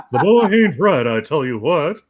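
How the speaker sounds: Hank Hill grumbling about his son; Hank Hill impression. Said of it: "That was great".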